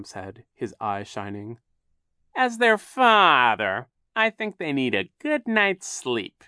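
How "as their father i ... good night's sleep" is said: high, loud, slow villain; drawn-out show of false concern; Captain Sham, feigned concern. A narrator's reading. Captain Sham poses as father.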